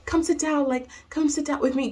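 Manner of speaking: high-pitched, repetitive coaxing; imitated flustered teacher. Teacher coaxing a crying student to sit, high fluster. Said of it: "like, freaking out because I'm crying".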